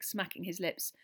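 usual speaking voice

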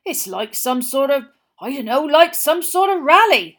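the owner grumbles to Mr Weasley about odd campers; grumbling campsite owner. A narrator's reading. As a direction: grumble about the weirdos on your field. Owner describes the crowd.

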